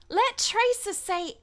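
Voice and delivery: high, slow, cheeky Tracer read. Pretend. Streamer reading out a request in Tracer's voice. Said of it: "just gotta get into Tracer".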